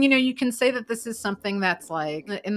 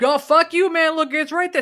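much higher, louder angry yell; mock angry yell; 9 semitones higher, 8 dB louder than usual. Arguing with the truck driver, mock angry yell. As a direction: curse at him and point. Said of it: "I'm like".

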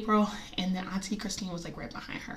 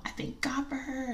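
whisper; 6 semitones higher, 6 dB quieter than usual. Retold or imitated. Quoting grandmother in the video.

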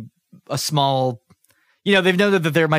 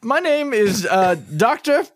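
lightly voiced flustered scientist, higher; a hint of a fumbling lab doctor, pitched up; nervous scientist; 6 semitones higher than usual.

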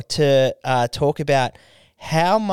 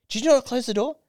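raised, higher mock interrogation; lightly voiced annoyed boyfriend grilling her; accusing boyfriend; 7 semitones higher, faster than usual. Boyfriend questioning girlfriend about the open door, mildly accusing. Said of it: "He's like".

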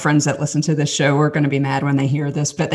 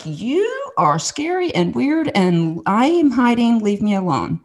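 high, slow, drawn-out mock wariness; wary shy kid; 7 semitones higher, slower than usual. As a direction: warn off a stranger in a high, slow voice. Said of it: "I'm looking at her like".

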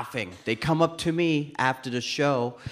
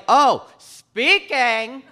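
high, loud, very drawn-out reply; eager kid answer; 9 semitones higher, 5 dB louder, much slower than usual. Acted. Child tells adult his career dream, eager and drawn-out. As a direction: proudly stretch it out like a keen child.

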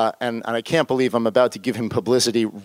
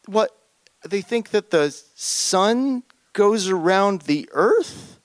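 high, slow, baffled question; incredulous disbelief; 6 semitones higher, slower than usual. Retold or imitated. Asking Tragen in disbelief, high and slow. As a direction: ask as if it can't be true. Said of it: "I take a look at this and I say".

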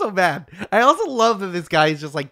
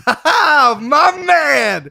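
high, loud, hearty Denzel cackle; Denzel impression; 9 semitones higher, 6 dB louder, much slower than usual. Cohost jokes about a Denzel laugh, loud high swagger. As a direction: throw it out like a swaggering movie star. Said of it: "Or Denzel".

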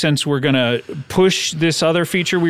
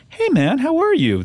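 higher, slow and friendly greeting; 10 semitones higher than usual. Shown as a demonstration. Retelling Todd's approach to sound friendly, easygoing. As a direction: make Todd sound like a nice guy. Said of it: "Whereas if I was like".

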